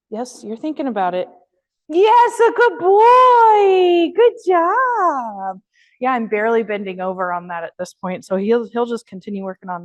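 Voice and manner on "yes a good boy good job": high, loud, slow sing-song praise for the dog; delighted dog praise, much higher and louder; excited praise. Real, not acted. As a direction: gush over a good dog.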